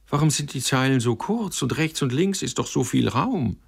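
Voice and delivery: high-pitched, bewildered question; baffled. A narrator's reading. Sender leafs through his first verse book, baffled. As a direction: ask it high, in naive puzzlement. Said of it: "Aber noch verblüffter ward er".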